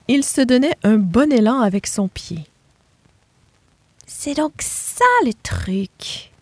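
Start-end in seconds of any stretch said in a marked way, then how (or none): 4.1-6.3 slow, higher voice of the little pig working it out; a lightly voiced piglet drawing out her discovery; little pig realizing. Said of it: "conclut Rosie".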